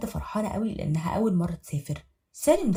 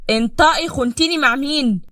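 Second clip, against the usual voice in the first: loud raised husband yelling; furious accusing; 7 semitones higher, 10 dB louder than usual. Acted. Husband beating wife, yelling accusations. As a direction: demand she speak up.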